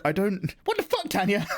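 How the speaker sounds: high put-on incredulous squeal at Tanya; mock outrage. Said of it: "I was like".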